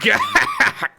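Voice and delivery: loud triumphant evil cackle; villain laugh. Acted. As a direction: cackle like a villain who just won. Villain vows to claim mermaids.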